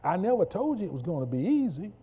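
building from firm to a roaring, high climax; full-force gospel preaching; fiery preaching. Acted. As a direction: preach it with rising fire until the shout.

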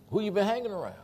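high-pitched challenge; retold disapproving question. Imitating his pastor after a slip, high disapproving question.

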